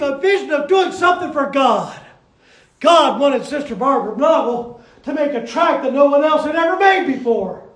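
loud, rousing pulpit preaching; preaching at full force. Acted. Preacher rallying the church behind Sister Barbara, loud and fervent. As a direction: whip the congregation up with every phrase.

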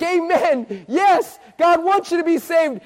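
high, rejoicing preacher's amen; exultant affirmation. Retold or imitated. Preacher answers girl who wants to be saved, exultant. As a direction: cheer her on with a lifted voice.

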